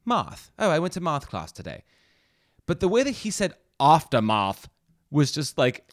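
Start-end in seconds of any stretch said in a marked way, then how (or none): 0.0-1.8 "math" with a broad British ah, raised pitch; chirpy British "math class today". Shown as a demonstration. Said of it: "math would be like". 3.8-4.7 a hint of a put-on British "aftermath"; overdone fake British "aftermath"; bad British accent. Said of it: "somebody putting on a really bad dialect".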